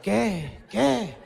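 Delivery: mock-impatient old lady pressing; a hint of grandma asking what; insistent grandma.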